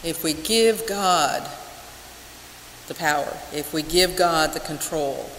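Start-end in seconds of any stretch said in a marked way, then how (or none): none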